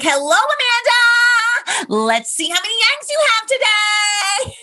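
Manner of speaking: high, slow, sing-song chipper greeting; very chipper nurse. Retold or imitated. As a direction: gush like an overly cheerful ultrasound nurse. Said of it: "like, very chipper".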